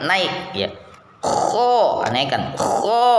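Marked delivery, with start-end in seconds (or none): 1.2-2.1 kho dengan pangkal lidah naik; kho tebal, pangkal lidah terangkat; pelafalan kho. Shown as a demonstration. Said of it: "pangkal lidahnya itu naik". 2.6-3.1 kho dengan pangkal lidah naik; kho tebal, pangkal lidah terangkat; pelafalan kho. Shown as a demonstration. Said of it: "ujung lidahnya tetap diem".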